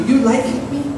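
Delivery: pleading high question; Jesus's voice. Acted. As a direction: ask it as Jesus would. Preacher voicing Jesus's question.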